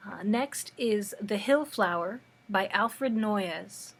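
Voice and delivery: ordinary delivery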